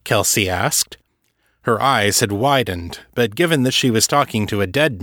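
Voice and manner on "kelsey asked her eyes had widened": ordinary delivery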